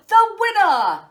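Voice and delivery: loud high proclamation; cried out. Acted. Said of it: "cried the Jade Emperor".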